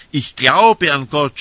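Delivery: loud, high, slow cry of faith; pilgrim crying out his faith to God; shouted confession. Retold or imitated. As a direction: shout it out as a believer overwhelmed. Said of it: "schrien die anderen".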